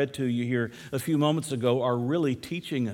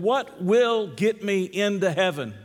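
slow, higher-pitched emphatic question; pressing teacher; 6 semitones higher, 3 dB louder than usual. Retold or imitated. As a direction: press the class for the answer, word by word.

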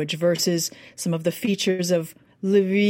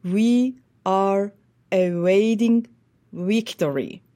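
very slow, "awaiting" with a w halfway to v; Western Ukrainian accent; slower than usual. Retold or imitated. Coach repeating Zelensky's line in Western Ukrainian accent. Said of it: "So he would have said".

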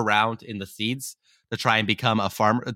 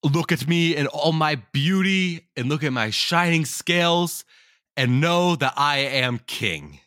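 slow, higher booming boast from a dragon; 4 semitones higher, slower than usual. A narrator's reading. Dragon showing off to the world, slow booming boast. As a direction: proclaim it slowly like a vain dragon king. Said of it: "in a booming voice".

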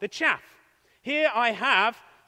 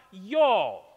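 put-on Southern drawl, low and loud; Southern accent; 4 semitones lower, 6 dB louder than usual. Shown as a demonstration. Preacher showing how the South says it, Southern accent. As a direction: drop into a hammed-up Southern twang. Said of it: "How's my accent?".

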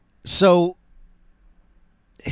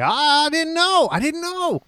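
high, squeaky mock apology; a sheepish fool pleading innocence, pitched way up; naive hero. Reviewer mimicking the clueless student apologizing, high squeaky plea.

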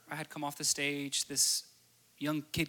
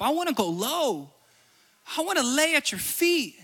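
full-force worship sermon; fervent preaching; 4 semitones higher than usual. Acted. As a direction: preach it out with rising fire.

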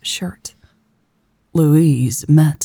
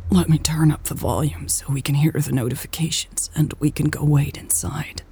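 soft unvoiced whisper; 5 dB quieter, faster than usual. Elle to faint Louise, whispered. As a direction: whisper so as not to disturb her.

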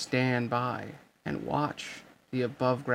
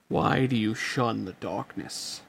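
a distant voice from nowhere; disembodied low voice; low echoing voice; 2 semitones lower than usual. A narrator's reading. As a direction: call out from the shadows, low. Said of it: "that low voice rang out".

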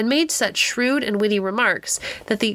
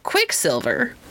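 doubtful repeat; 3 semitones higher, slower than usual. Acted. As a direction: turn the name over skeptically. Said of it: "repeated Philemon".

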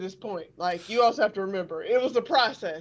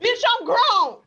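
high, loud and slow put-on voice; sassy mock retort; 11 semitones higher, 7 dB louder, slower than usual. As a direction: fire it back like an offended grown-up.

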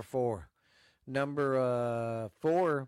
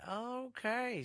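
mock okay; 10 semitones higher, 4 dB quieter than usual. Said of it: "she'll be like".

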